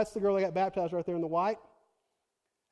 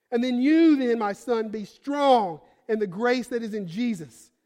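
louder fervent scripture reading; preaching scripture; 2 semitones higher, 7 dB louder than usual. Real, not acted. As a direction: proclaim the verses to the congregation. Reads scripture at full preaching force.

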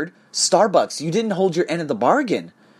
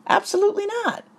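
high emphatic denial. Real, not acted. Emphatic denial about his boss.

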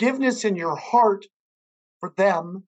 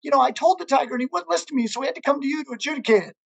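high-pitched mock screaming without the volume; high, pleading donkey tattling; 6 semitones higher, faster than usual. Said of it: "starts screaming".

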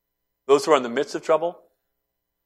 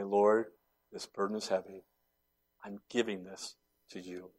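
soft, humble prayer voice; hushed prayer; 3 semitones lower, 8 dB quieter than usual. Acted. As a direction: lay it down quietly before God.

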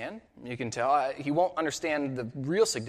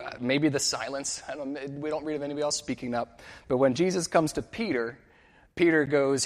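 ordinary talk, nothing marked

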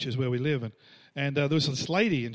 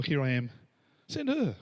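joking pass-the-buck; 3 semitones lower than usual. Acted. Preacher jokes about nominating another for God's call, mock volunteering. Joke twist, volunteering someone else.

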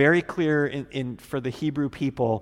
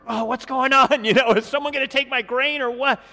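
startled, high and loud, laughing through it; hammed-up startled Boaz waking up; 9 semitones higher, 6 dB louder, faster than usual. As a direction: jolt awake, alarmed about the grain. Pastor voices startled Boaz.

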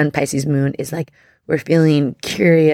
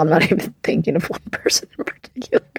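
whispered through a little laugh; embarrassed whisper with a giggle; faster than usual. Real, not acted. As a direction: hush it, half laughing. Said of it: "I'm getting emotional".

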